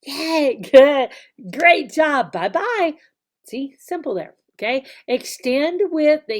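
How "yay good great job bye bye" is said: loud cheerful yay good job; bright teacher praise. Shown as a demonstration. Teacher praising a young learner, loud cheerful praise. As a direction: cheer the child on.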